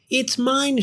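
high, eager squirrel claim; mock shout. A narrator's reading. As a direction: grab it with your voice. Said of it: "shouted Cyril".

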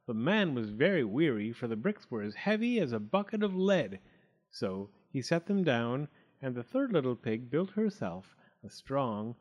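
usual speaking voice

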